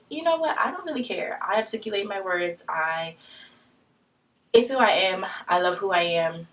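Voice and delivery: normal talking voice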